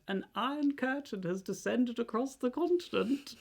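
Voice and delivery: high put-on grandma voice for Churchill; hammed-up grandma voice for Churchill. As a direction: squeak it out like a little old grandma. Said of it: "like your voice you do for grandmas".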